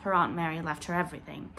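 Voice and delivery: usual speaking voice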